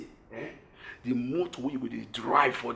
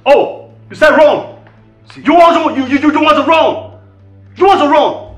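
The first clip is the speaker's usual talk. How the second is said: high, very loud incredulous rage; furious outburst; 11 semitones higher, 17 dB louder than usual. Acted. Confronting partners who want to run, furious shouting. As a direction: shout it in outraged disbelief.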